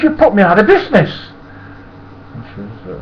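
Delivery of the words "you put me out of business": high, loud, fast indignant complaint; ruined window cleaner, loud and indignant; angry accusation. Retold or imitated. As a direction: throw the blame at him. Ernie accusing.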